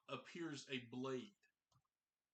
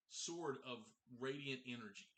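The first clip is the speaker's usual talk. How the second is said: normal talking voice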